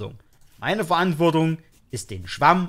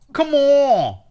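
exasperated shout; 10 semitones higher, 6 dB louder than usual. Real, not acted. Annoyed at the game.

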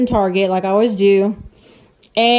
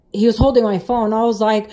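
normal talking voice

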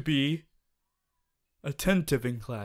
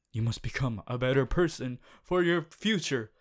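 ordinary talk

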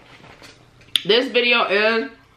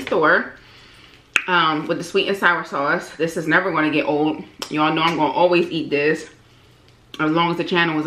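talking behind food in hands; speaking over a chicken wing; chicken held at mouth; 3 semitones lower than usual. Real, not acted. Seen 2: tears chicken wing right in front of her mouth while talking.

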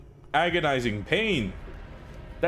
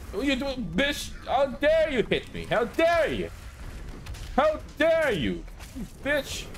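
high falsetto indignation, much higher; mock outrage; 11 semitones higher than usual. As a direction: play the offended diva in a squeaky voice. Offended by enemy hit.

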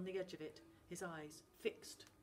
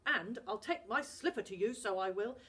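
loud, stern scolding; louder gruff father handing out punishment; angry Scottish dad; 3 semitones higher, 9 dB louder than usual. Acted. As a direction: lay down the law to the boy.

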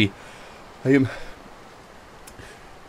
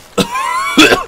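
harsh coughing fit; choking cough from the spice; hot-pepper cough; 3 dB louder than usual. Real, not acted. Habanero burn, coughing fit.

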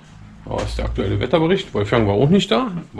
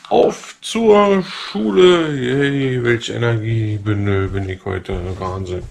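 mock enthusiasm deflating; 4 semitones lower, much slower than usual. Rousing everyone for school with mock energy, deflating sarcastically. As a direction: start with a slow rousing call, then let the energy drain away.

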